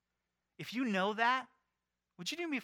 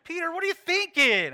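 much higher, louder laughing rebuke; scolding Jesus; 12 semitones higher, 12 dB louder than usual. Pretend. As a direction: tease Peter with a laughing scold. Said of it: "I'm embellishing now".

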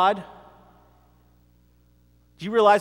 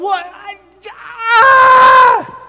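high, loud exasperated yell; strangled shout of frustration; frustrated cry; 13 dB louder, much slower than usual. Retold or imitated. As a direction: throw up your hands and cry out ah. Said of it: "And I'm going".

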